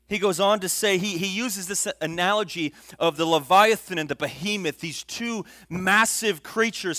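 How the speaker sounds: loud, high sermon drive; fiery preaching. Acted. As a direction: keep the pulpit fire going. Sustained preaching at volume.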